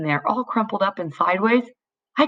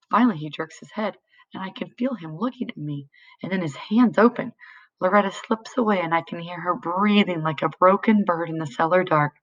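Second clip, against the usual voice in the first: plain reading voice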